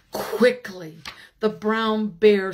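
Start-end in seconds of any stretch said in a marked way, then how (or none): none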